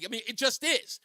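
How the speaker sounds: usual speaking voice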